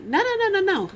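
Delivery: high run of no; high-pitched insistent refusal; emphatic no's. Real, not acted. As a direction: swat the claim away. Emphatic rejection.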